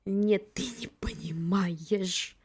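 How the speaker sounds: slow hushed whisper. Real, not acted. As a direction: whisper it slowly, conspiratorially. Hushed aside to the character.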